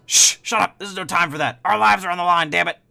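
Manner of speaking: high, loud frantic kobold scolding his partner; panicked kobold hushing. Acted. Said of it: "the other one goes".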